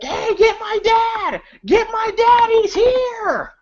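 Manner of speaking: high, frantic shouting; panicked shout. Retold or imitated. Nancy begging the cops to get her dad, frantic shout. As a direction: yell for help in a panic.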